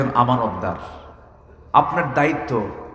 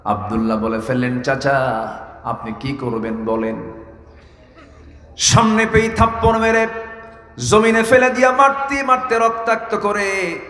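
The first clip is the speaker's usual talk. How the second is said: melodic sermon storytelling; 3 semitones lower than usual. A narrator's reading. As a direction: intone the tale in long sustained notes.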